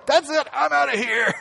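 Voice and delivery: high, laughing mock exit line; flippant bailing out. Simon imagined ditching Jesus, laughing mock exit. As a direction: toss it off like walking out on Jesus. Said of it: "He doesn't go".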